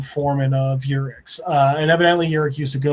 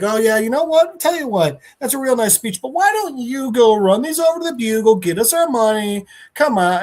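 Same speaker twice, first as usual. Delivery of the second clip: higher-pitched jaded brush-off; 6 semitones higher than usual. Retold or imitated. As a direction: imitate a jaded old hand. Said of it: "he's just so jaded".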